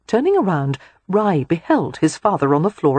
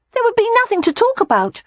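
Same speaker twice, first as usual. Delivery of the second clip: put-on high, quick child voice; small boy arguing his point; protesting little boy; 4 dB louder, faster than usual. A narrator's reading. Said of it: "Rye protested".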